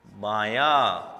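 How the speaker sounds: बाया drawn out slowly and low; slow demo. Shown as a demonstration. Teacher says the joined word slowly. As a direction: stretch बाया out. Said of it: "क्या word बना?".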